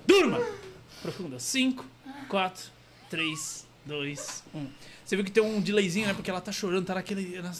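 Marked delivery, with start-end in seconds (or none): 0.1-4.7 hypnotist's fading countdown; hypnotic induction. Real, not acted. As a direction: snap the command, then count down ever lower and softer. Hypnotist puts a woman to sleep.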